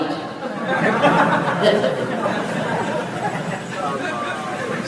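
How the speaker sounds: soft airy chuckle; breathy laugh. Real, not acted. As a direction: laugh quietly under the breath. Preacher laughs at joke. (tawa tertahan)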